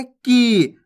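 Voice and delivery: "की" with a long, held ee; drawn-out vowel. Shown as a demonstration. Teacher modelling the big ee sign, "की" stretched. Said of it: "खींच के पढ़ेंगे".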